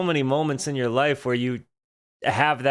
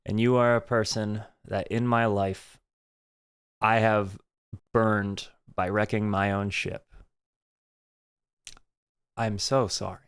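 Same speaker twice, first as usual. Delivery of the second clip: lower, softer heartfelt confession; quietly sorry, from the heart; sincere apology; 5 semitones lower, 3 dB quieter than usual. Real, not acted. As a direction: speak it gently, as a real apology to people you hurt. Heartfelt apology to people hurt.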